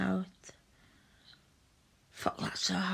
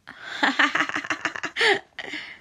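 bright real laugh, rising; a real giggle at her own joke; amused laugh; 7 semitones higher, 4 dB louder than usual. Real, not acted.

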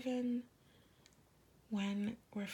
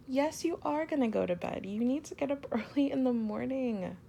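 louder, teasing mock-strict tone; playful scolding; 3 semitones higher, 8 dB louder than usual. Girlfriend sending her partner to bed, playful mock scold. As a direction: boss them off to bed with a grin.

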